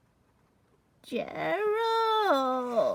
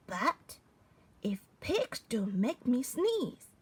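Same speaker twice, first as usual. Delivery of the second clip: ordinary delivery, nothing marked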